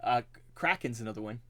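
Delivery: ordinary talk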